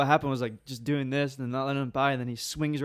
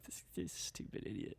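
much lower, whispered dad's contempt; muttered scorn; 7 semitones lower, 20 dB quieter than usual. Retold or imitated. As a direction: mutter it like an unimpressed dad.

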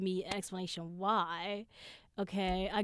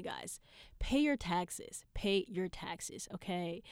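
usual speaking voice

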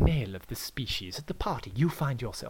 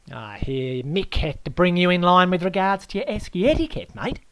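loud, pitched-up Aussie bloke telling him off; Aussie host scolding; 5 semitones higher, 7 dB louder than usual. Acted. Host Aaron chides the guest about the esky, loud Aussie scolding. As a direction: lay down the law, matey and loud.

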